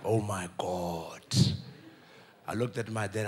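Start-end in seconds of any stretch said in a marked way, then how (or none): 0.1-1.2 dragged-out soft gasp of awe; slow-motion awe. Retold or imitated. Kid watching slow motion, slow awed whisper. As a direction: stretch it out low and slow. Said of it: "I was like".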